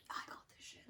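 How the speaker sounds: hushed cocky whisper. Retold or imitated. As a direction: whisper it to yourself, full of bravado. Confident kid at bat.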